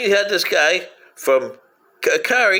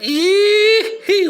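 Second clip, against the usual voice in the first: whoop; 4 dB louder than usual. Acted. Mocking whoop.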